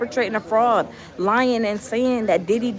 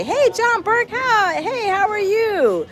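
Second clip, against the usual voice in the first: bright greeting; 12 semitones higher, slower than usual. Real, not acted. Greeting a viewer in chat.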